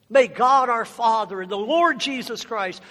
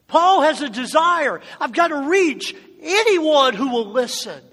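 preach; 3 semitones higher, 5 dB louder than usual. Acted. Preaching at full force.

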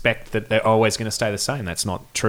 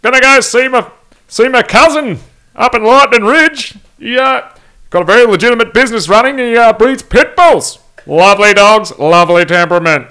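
obnoxious dim-witted Aussie; 14 semitones higher, 13 dB louder than usual. Retold or imitated. Band member voicing made-up bloke Nev from Lightning Ridge, hammed-up high loud Aussie voice. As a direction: ham up a gross, dim bogan bragging about Burger King. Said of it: "That kind of gross voice".

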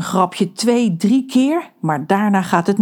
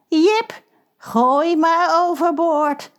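slow, chilling, cold drawl; a voice as cold as a butcher's knife; ice-cold shark voice; 10 semitones higher, much slower than usual. Acted. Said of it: "een stem zo koud als een slagersmes". (koude haaienstem)

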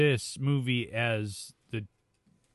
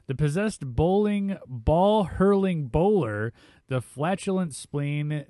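announcer-style synopsis read; 5 semitones higher, 4 dB louder than usual. Real, not acted. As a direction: punch each name with showy pauses. Host reads film synopsis.

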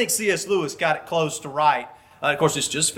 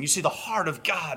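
ordinary talk, nothing marked

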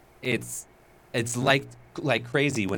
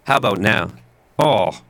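crackling, broken-up line; crackly voice; 3 semitones lower, 7 dB louder than usual. Real, not acted. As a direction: still crackling and distorted. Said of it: "Still crackly".

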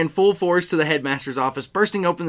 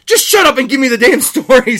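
exasperated shout; 9 semitones higher, 9 dB louder than usual. Acted. Snape defeated snapping.

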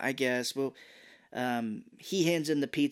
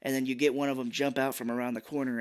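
ordinary delivery

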